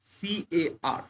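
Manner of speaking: drawn-out letters for students; teacher spelling CAR slowly. Shown as a demonstration. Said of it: "উদাহরণ দেই".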